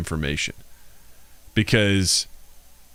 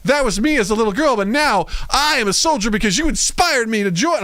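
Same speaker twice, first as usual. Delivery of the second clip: mock girl soldier; 11 semitones higher than usual. Character voiced in retelling.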